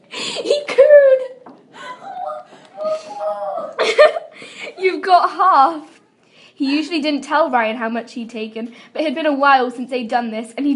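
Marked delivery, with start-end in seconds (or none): 0.1-4.8 much higher and louder, giggling; mock cooing, laughing. Real, not acted. 4.8-6.0 slow, louder, laughing through it. Real, not acted. Reader laughing mid-scene.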